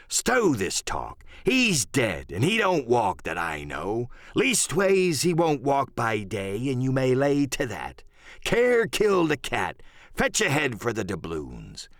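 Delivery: slow, swooping pirate voice; Silver, commanding pirate leader. Acted. Silver cutting short the ghost talk, slow commanding pirate voice. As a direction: draw out the words to settle the crew.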